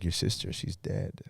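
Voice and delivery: quiet, slow, tearful mother's voice; hushed grieving mother, slow; mother crying. Retold or imitated. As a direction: deliver the news softly through tears. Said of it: "She was crying".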